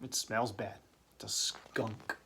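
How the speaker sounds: hushed playful whisper. Acted. Teacher whispering skunk clue to students.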